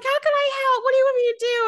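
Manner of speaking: high eager little fish voice; Flounder voice. Retold or imitated. S0 voicing Flounder offering help, high and eager. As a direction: squeak it out, keen to help. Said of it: "He's like".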